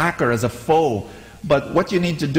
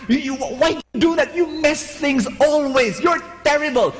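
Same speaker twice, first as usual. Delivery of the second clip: much higher, hammed-up ranting scold; mock screaming rant; 11 semitones higher than usual. Controlling friend scolding, hammed-up high rant. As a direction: imitate someone blowing her top. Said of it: "screams and blows her top".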